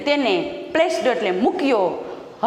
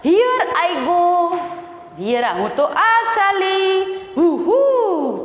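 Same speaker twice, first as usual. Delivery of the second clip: high, drawn-out joyful whoop; sing-song exclamation, pitched up; crying out aloud; 7 semitones higher, much slower than usual. Acted. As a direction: call it out like a thrilled rider. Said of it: "ચીસ પાડીને બોલી ઉઠવું".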